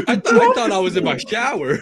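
laughing while saying it; laughing through words. Pretend. Friend riffing on the naked beach joke, laughing. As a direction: giggle through the line.

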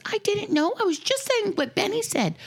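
high-pitched imitation of a defensive kid; protesting child. Child protests when told off.

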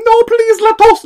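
high-pitched pleading in a mock Mexican accent. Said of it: "je vais se faire un accent mexicain". (accent mexicain suppliant, suraigu et caricatural)

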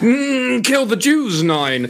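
high parody of a Nazi officer; mock Nazi voice. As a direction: ham up a Nazi. Mocking Nazi voice joke.

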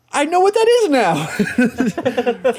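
high, slow, laughing triumph; giddy laughing. Retold or imitated. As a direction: crow it through a laugh. Retold proud nerd moment.

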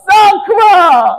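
very high, loud screech; yelling at the driver. Retold or imitated. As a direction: scream orders at the cabbie as the wife. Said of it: "התחילה לצעוק על הנהג". (צעקות על הנהג)

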